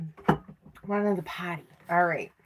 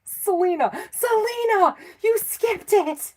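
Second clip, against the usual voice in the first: mock mods calling out; 11 semitones higher than usual. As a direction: pipe up high like a tattling viewer. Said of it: "just yell at me next time".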